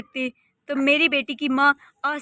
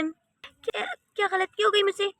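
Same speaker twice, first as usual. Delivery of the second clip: ordinary delivery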